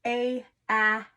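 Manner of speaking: short a sound stretched out; vowel sound demo. Shown as a demonstration. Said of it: "let's do a quick review".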